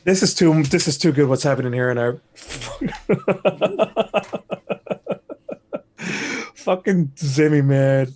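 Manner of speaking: loud, laughing delight. Real, not acted. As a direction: crack up while talking. Laughing at donation message.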